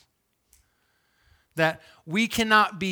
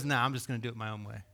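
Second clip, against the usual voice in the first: casual Jesus; 4 semitones lower, 6 dB quieter, much faster than usual. Retold or imitated. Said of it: "he looks at them and he goes".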